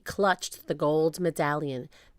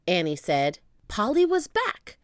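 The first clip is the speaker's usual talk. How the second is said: ordinary delivery, nothing marked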